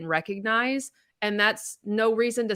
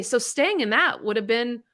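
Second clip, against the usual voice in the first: usual speaking voice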